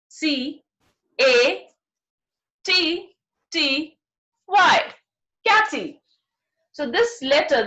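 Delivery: usual speaking voice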